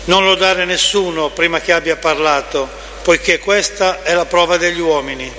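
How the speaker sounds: usual speaking voice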